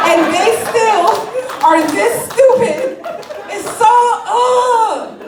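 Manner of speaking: high, loud, strangled groan of disbelief; a laughing squeal of pure exasperation; exasperated outburst. Real, not acted. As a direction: let the frustration burst out as a yelp.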